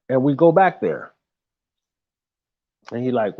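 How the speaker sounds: ordinary talk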